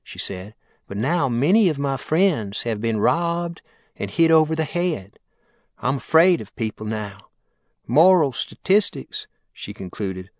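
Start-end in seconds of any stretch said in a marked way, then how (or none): 0.9-9.3 higher sweet Southern drawl, rising with worry; a sweet lady voicing her fear of robberies. A narrator's reading. Caller tells the mayor her fears, high sweet drawl. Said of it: "her sweet drawl".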